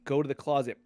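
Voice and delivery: usual speaking voice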